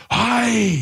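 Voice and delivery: high, drawn-out cry of amazement; high-pitched mock awe; awestruck cry. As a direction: retell a dealer gasping at royalty. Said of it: "as if the Prince of Zamunda walked in".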